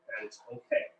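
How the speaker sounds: softly whispered reassurance; whispering. Shown as a demonstration. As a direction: whisper it gently to the viewers. Repeats reassurance more softly.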